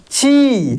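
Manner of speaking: ची with a long held ई; teacher demo of a stretched ची; long ee sound. Shown as a demonstration. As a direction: pull the vowel in ची out long. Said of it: "उसका उच्चारण खींच कर होगा, लंबा होगा".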